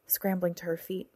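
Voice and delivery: plain reading voice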